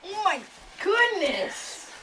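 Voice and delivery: very high squealing oh my goodness; shrieked amazement. Acted. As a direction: gasp it out in delight. Amazed customer in ad.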